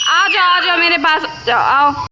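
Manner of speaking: high, slow coaxing call. Real, not acted. Coaxing baby to come over.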